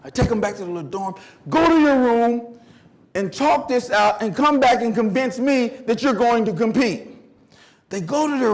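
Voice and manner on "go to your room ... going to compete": raised, higher commanding voice; stern coach order. Retold or imitated. Coach sending runners to their room, stern loud order. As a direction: send them off with a loud telling-off.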